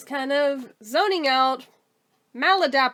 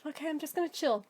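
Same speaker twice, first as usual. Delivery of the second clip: softly calming herself; 2 semitones higher, 11 dB quieter, faster than usual. Retold or imitated. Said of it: "I figure".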